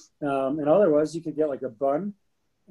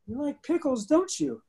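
squeaky, much higher little voice; 11 semitones higher than usual. Retold or imitated. Man retelling meeting his wife, mimicking her tiny high voice. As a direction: imitate her tiny, high-pitched teasing question. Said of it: "the way that this looked would be like".

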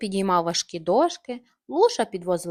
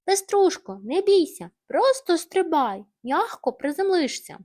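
higher, louder call to the hen; 8 semitones higher, 5 dB louder than usual. Acted. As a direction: coax her down from afar. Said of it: "Тім гукнув". (гучний підбадьорливий заклик угору)

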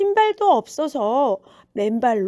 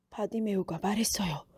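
usual speaking voice

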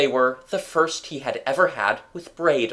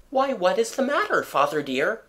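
much higher, gently teasing; mother's light voice; 8 semitones higher than usual. A narrator's reading. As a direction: ask the husband sweetly what's wrong.

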